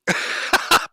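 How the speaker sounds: short wheezing giggle; real squeaky laugh; high breathy laugh. Real, not acted. Reactor laughs.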